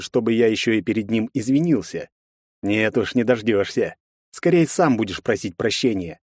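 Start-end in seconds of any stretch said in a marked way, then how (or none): none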